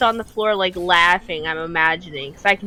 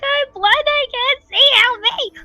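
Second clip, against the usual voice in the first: much higher, desperate comic plea; mock pleading panic. Blinded by pepper, begging his laughing brother for help in a high wail. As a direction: wail for help like a helpless child.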